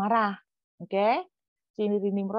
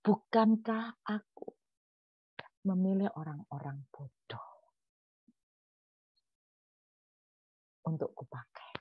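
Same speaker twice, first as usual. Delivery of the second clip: God answering gently; 5 dB quieter than usual. Retold or imitated. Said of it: "Tuhan jawab begini".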